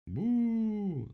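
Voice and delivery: long, loud, high boo; animals booing. A narrator's reading. Animals answer the charge, booing loudly. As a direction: jeer with a drawn-out boo. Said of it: "закричали звери".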